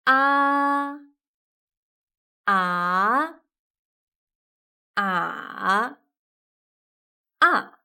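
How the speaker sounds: ā, á, ǎ, à said one after another; four tones. Shown as a demonstration. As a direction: climb through the four tones on a. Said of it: "we have four tones".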